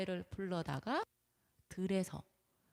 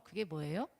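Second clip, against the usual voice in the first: ordinary talk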